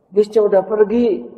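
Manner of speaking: put-on child reports to his mother; high child voice. Boy tells his mother the bus is gone, high.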